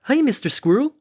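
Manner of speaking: high, cheerful hail; cheerful Derpy. A narrator's reading. Derpy hails the onrushing Harmony, cheerful. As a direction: call out a blithe high greeting. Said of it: "The wall-eyed Pegasus cheerfully waved back".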